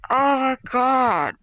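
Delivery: drawn-out, loud and high oh my God; exasperated groan at the hopeless friend. Retold or imitated. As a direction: moan it slowly in disbelief. Exasperated at the bear wish.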